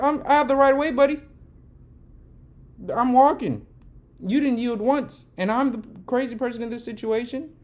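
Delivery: loud, high indignant retort; yelling back. Retold or imitated. Answering the honking driver, loud indignant yell. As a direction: shout your right of way at him. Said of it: "I found myself yelling".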